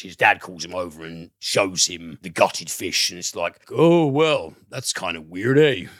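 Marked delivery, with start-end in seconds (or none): 3.7-5.9 hammed-up Canadian lilt with a lifted "eh?"; badly faked Canadian twang, loud on the "Oh, well"; terrible Canadian accent. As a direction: do a bad, exaggerated Canadian accent. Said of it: "that's a terrible Canadian accent".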